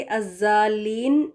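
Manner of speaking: الضالین said slowly as "az-zaa-leen"; wrong reading of الضالین with buzzing z; mistaken z sound. Shown as a demonstration. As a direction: put a z in place of Daad in الضالین. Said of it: "زیڈ لگا کے".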